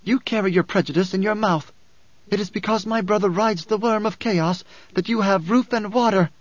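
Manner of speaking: high, fierce denunciation; snarling. A narrator's reading. Alia defends her brother to the crowd, snarling. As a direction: denounce them, rising to the last word. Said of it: "Alia snarled".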